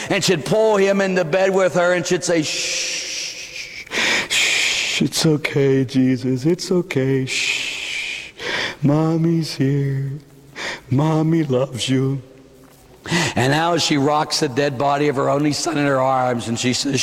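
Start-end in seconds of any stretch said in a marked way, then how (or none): 2.4-12.2 rhythmic shushing. Acted. Rhythmic comforting after nightmare.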